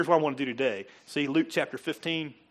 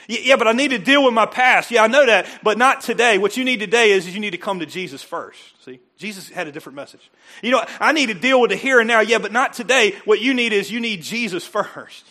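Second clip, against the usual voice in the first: loud, higher preaching voice through the exchange; full-force preaching; 6 semitones higher, 11 dB louder than usual. Acted. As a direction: argue it out with the congregation, loud. Acted back-and-forth at full preaching force.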